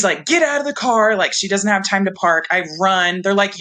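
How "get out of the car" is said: urgent mom. Retold or imitated. Mom rushing her out.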